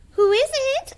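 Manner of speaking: high, loud granny call; old granny voice. Acted. Granny calls out.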